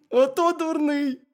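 high, loud hedgehog squeak; amused, very high critter voice; little hedgehog. A narrator's reading. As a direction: pipe it up slowly as a tiny hedgehog. Hedgehog mocks the hare.

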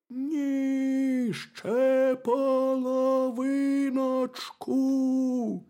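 high, drawn-out hare from underground; hollow, high wailing hare; muffled burrow voice. A narrator's reading. Hare answers from inside burrow.

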